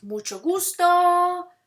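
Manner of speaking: slow, loud, high model phrase; repeat-after-me model. Shown as a demonstration. Teacher modeling useful expressions for students, slow and loud. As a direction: draw it out loudly for learners to copy. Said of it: "You should repeat after me".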